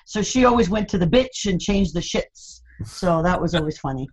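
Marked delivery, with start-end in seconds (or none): 1.1-2.6 "beach" as "bitch", "sheets" as "shits"; mother's mispronunciation. Retold or imitated. Imitating mother's imperfect English, short i in "bitch" and "shits". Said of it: "never got the English particularly perfectly".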